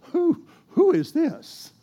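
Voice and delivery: high, slow, wondering "who is this"; surprised. Shown as a demonstration. Alternate take, startled Boaz.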